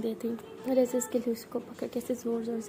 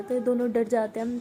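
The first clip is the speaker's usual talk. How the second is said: ordinary delivery, nothing marked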